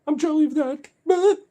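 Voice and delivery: very high, loud squealing jealous dog; mock freaked-out dog. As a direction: voice a dog's jealous outburst, loud squeaky falsetto. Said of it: "freak out and be like".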